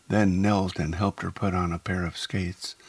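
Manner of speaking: usual speaking voice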